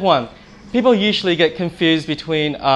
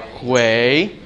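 "hui" stretched and swept upward; rising tone; 4 semitones lower than usual. Shown as a demonstration. Teacher showing students the second tone, long rising "hui". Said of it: "you can go up".

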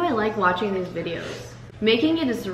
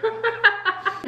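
laughing; 13 semitones higher than usual. Real, not acted. Stretched laughing word.

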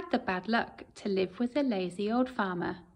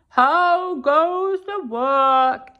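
slow loud farmer call; waking farmer calling; 4 semitones higher, 9 dB louder, much slower than usual. Acted. Farmer woken by the rocking bed calling out.

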